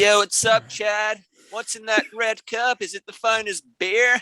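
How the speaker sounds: hammed-up Surfer Boy California accent, slow on the question; a Brit mocking an American frat guy with a red cup. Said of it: "let's hear your American accents".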